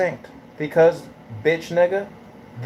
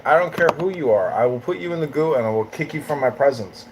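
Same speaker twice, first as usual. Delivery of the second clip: usual speaking voice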